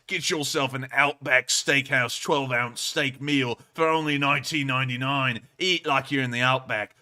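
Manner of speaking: TV ad announcer reciting a steak deal; Outback commercial read. Acted. Streamer recites an old Outback ad to co-host, commercial read. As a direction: sell the steak meal like a TV spot. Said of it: "remember that commercial line by line".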